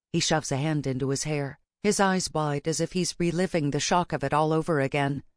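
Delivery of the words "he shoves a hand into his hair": ordinary delivery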